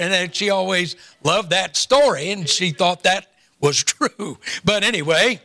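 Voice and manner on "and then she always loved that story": ordinary talk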